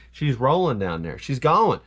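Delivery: ordinary talk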